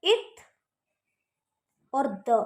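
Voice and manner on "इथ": teacher naming the th stroke; th sound name. Shown as a demonstration. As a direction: say "इथ" clearly, ending on th. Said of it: "इसको हम बोलेंगे".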